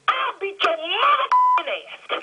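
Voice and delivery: high, slow, crying threat; tearful fury. Real, not acted. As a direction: lose it completely in a rage. Phone argument boils over.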